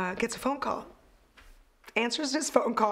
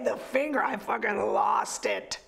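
usual speaking voice

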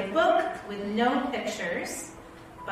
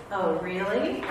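usual speaking voice

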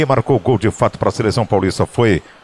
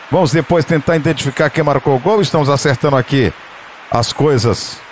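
ordinary delivery

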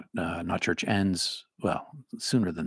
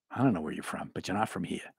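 put-on Cape Cod local's accent; 2 semitones lower, 7 dB quieter than usual. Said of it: "They're like".

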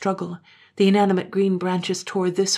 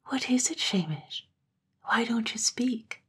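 uneasy whisper; 6 semitones higher, 3 dB quieter than usual. A narrator's reading. Katrine pressing the silent man in fear. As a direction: whisper it, sensing something wrong.

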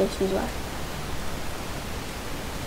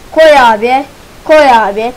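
loud urging; 9 dB louder than usual. Real, not acted. Urging game to accept item.

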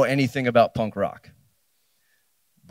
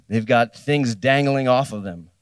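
ordinary talk

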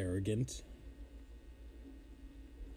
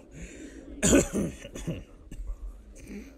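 cough; 8 semitones higher than usual. Real, not acted. Cough after laughing fit.

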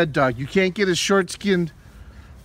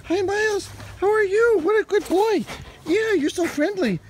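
much higher, cooing puppy voice; 12 semitones higher than usual. Real, not acted. Greeting the rescue dog Miles.

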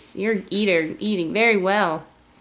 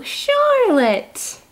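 excited; 4 dB louder than usual. Real, not acted. Naming the baby brightly.

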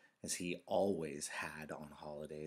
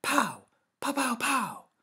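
loud, high pretend gunfire; excited playground shooting sounds; child's gun noises; 13 dB louder, slower than usual. A narrator's reading. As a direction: shout out toy gunshots in a burst. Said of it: "A child's voice ... made the sound".

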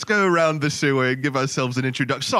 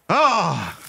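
mock monster roar; 4 semitones higher than usual. Snail leaps from the shadows at the reporter, mock roar.

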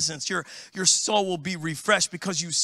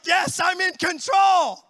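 slow, higher mock shout of being in control; smug triumphant shout; 9 semitones higher, 3 dB louder, slower than usual. Pastor mocking a lane-hogging driver, slow gloating shout. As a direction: crow it like you own the road. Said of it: "And, and we're like".

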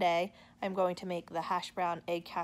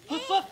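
high loud puppy woof; mother barking high for her toddler; high playful woof; 12 semitones higher, 8 dB louder than usual. Shown as a demonstration. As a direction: bark like a little puppy.

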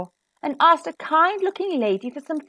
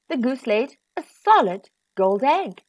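ordinary delivery

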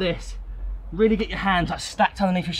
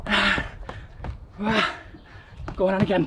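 ordinary talk

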